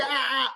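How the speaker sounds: very high, drawn-out "aah"; exaggerated pain scream. Shown as a demonstration. Doctor demonstrating an overreacting pain system, high mock scream. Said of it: "se grita".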